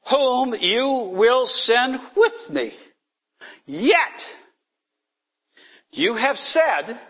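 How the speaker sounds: high, slow, solemn reading of the verse; scripture reading. Acted. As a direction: read the scripture slowly and reverently, pitched high. Scripture read aloud.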